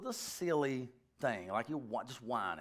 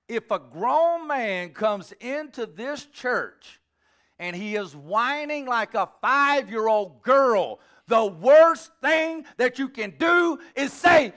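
slow, high, loud build to a shout; indignant preaching; 7 semitones higher, 11 dB louder, slower than usual. Real, not acted. Preacher warns against coddling grown whiners, indignant and loud. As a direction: hammer it out at rising volume.

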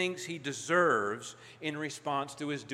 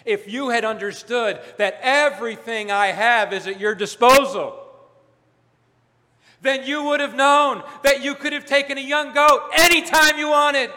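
louder and higher, rising into a joyful near-shout; passionate father; 8 semitones higher, 9 dB louder than usual. Retold or imitated. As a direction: build up to the father's exuberant plea. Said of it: "It's as if the father is saying".

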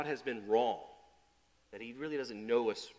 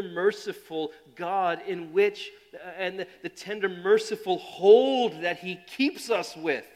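loud, slow sermon emphasis; preaching; 7 semitones higher, 11 dB louder, slower than usual. Acted. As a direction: drive home God's mercy. Preaching tender God.

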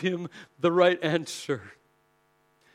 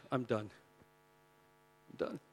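low, soft defeated disciple; 6 semitones lower, 12 dB quieter, faster than usual. Acted. Simon quitting after Jesus died, low and defeated. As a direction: say it quietly, like giving up.

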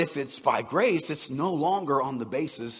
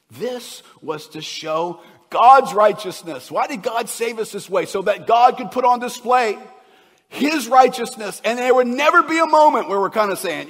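much higher, louder fervent preaching; preaching at full force; 10 semitones higher, 13 dB louder than usual. Acted. Pastor proclaiming God's righteousness, full force. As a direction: proclaim it to the rafters.

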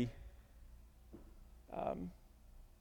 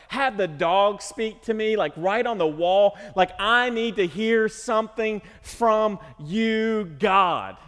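pleading with God; 5 semitones higher, 4 dB louder, slower than usual. Acted. Preacher voices his plea to God.

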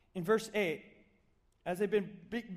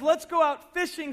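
higher, louder cheerful call; eager invitation; 6 semitones higher, 5 dB louder than usual. Retold or imitated. Jesus cheerfully inviting the fishermen out, eager and upbeat. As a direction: pitch it like a fun idea.

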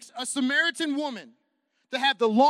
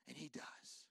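whispered, softer than before; whispered assurance; 7 semitones lower, 26 dB quieter, slower than usual. Real, not acted. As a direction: hush it like a secret. Quiet sermon climax.

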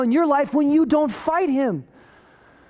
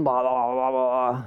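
low blah-blah drone; low, fading mock chatter; droning talker; 9 semitones lower, slower than usual. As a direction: imitate someone talking on and on, low. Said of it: "So he's talking".

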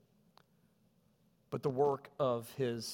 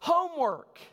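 put-on much higher, louder childish answer; kids' punchline; 8 dB louder than usual. Kids answering the teacher, loud high cheeky reply. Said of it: "And they said".

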